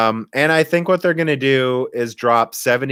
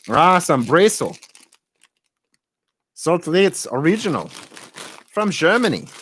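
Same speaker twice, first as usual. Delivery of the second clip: higher-pitched mock-German reading; hammed-up German accent; funny German accent; 5 semitones higher than usual. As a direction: read the label in comic German. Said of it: "the funniest accent ever".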